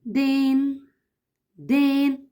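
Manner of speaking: first syllable spoken apart. Shown as a demonstration. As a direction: sound out دين as one beat. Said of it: "هتقولي كده دين".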